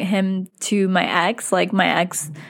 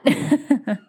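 short laugh; 4 semitones higher than usual. Real, not acted. Laughing at his behaviour.

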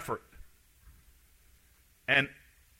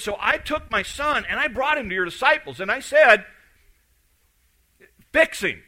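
a father's raised, pleading voice, pitched high; urgent, high retelling of a father's plea; 6 semitones higher, 5 dB louder than usual. Retold or imitated. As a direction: retell it as a desperate dad begging for help. Desperate father retold by preacher.

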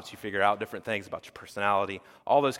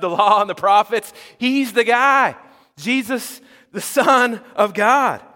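much higher, louder, bursting with excitement; excited Philip; 9 semitones higher, 8 dB louder than usual. Acted. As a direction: shout the news like you can't contain it. Philip excitedly telling Nathanael.

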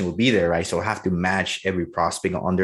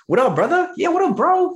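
put-on much higher and louder, pumped young dude; hyped bro greeting; 6 dB louder than usual. Salesman greeting a young prospect like a bro, hyped. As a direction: greet him like your buddy, all hype. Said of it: "if they're young, my age".

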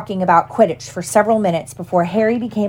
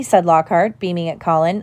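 plain reading voice

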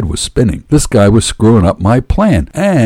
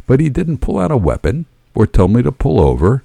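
plain reading voice

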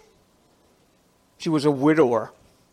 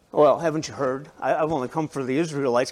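lower, quicker, playful testing tone; teasing with a smile; 6 semitones lower than usual. Retold or imitated. Jesus teasing the woman who asks for healing, smiling. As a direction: tease her gently, smiling through it. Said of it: "Can't you see a little smile on his face?".

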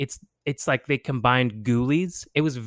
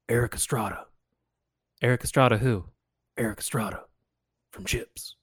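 deadpan punchline; 6 dB quieter than usual. Retold or imitated. Retelling the movie's knock-knock joke, soft and deadpan. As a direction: let the punchline drop quietly. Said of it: "the greatest joke of all time".

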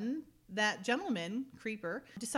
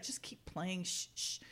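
hushed shushing; 4 semitones lower, 7 dB quieter than usual. Retold or imitated. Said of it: "He's like".

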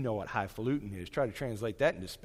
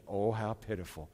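grandmother's sigh; 4 semitones lower, 4 dB quieter than usual. Retold or imitated.

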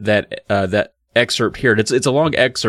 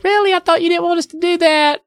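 very high put-on girl voices; mock girlish protest; imitated girls; faster than usual. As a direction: mimic puzzled girls in a squeaky high pitch. Voicing the girls' reply to dad.

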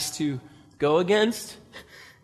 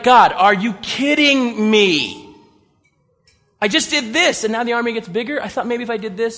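exasperated complaint; 4 semitones higher, 4 dB louder than usual. Retold or imitated. Preacher imagines complaining to God, exasperated. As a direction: whine it like a fed-up soldier. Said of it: "I would just be like".

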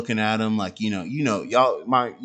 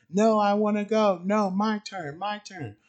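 put-on whining toddler; 10 semitones higher than usual. Son wailing to take his bowling turn, high mock whine. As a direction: play a tantruming toddler, lightly. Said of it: "he was screaming and whining".

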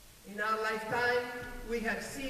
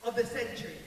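usual speaking voice

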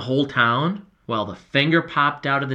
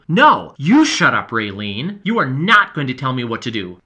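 angry retort; 7 dB louder than usual. Acted. Boy shouting back at the girl, furious. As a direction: fling it back at her. Heated quarrel.

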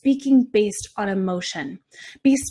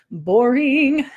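emphatic "boring"; 4 semitones higher, 6 dB louder than usual. Real, not acted. Mock-rejecting a dull freebie.

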